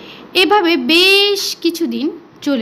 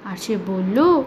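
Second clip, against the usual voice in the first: plain reading voice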